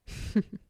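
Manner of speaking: short, airy laugh; breathy chuckle. Acted. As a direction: let out a quick chuckle. Patient reacts to being touched.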